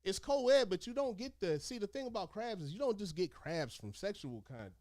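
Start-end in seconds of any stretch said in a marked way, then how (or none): none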